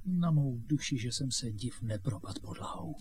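soft, fading murmur; stunned aside. Acted. Boy hears whom he is taken for, stunned and quiet. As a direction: confide it quietly, trailing away.

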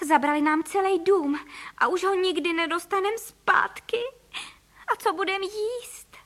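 high, plaintive wailing; storybook mother close to tears; tearful lament. A narrator's reading. Mother bird laments lost house.

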